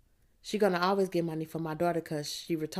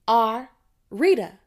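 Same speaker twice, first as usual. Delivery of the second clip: slow, very loud shout, pitched up; 4 semitones higher, 10 dB louder, slower than usual. A narrator's reading. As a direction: shout it out as your name's turn comes. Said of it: "That's Rita shout that".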